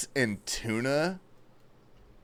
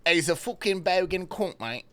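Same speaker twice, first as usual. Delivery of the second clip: a light put-on Aussie twang; rough Australian accent, hammed up; mock Australian accent; 5 semitones higher than usual. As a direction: play a lightly voiced Aussie bloke. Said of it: "I don't know how Australians say it".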